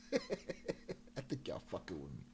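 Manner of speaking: soft, slow line spoken through laughter; laughing mock suspicion. Retelling X realizing the crew is messing with him, laughing. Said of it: "And he's like".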